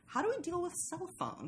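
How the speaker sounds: high, giggly mock panic over cell phones; mock bewildered filmmaker. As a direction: play a puzzled screenwriter, laughing. Mocking filmmakers' dilemma.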